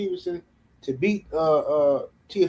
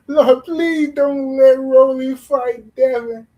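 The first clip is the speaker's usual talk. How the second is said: higher, slow, hammed-up begging to the Lord; mock pleading prayer; 7 semitones higher, 6 dB louder, slower than usual. Mocking Tank fans praying, hammed-up slow begging. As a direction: pray like a desperate fan, overplaying it. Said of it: "begging and pleading".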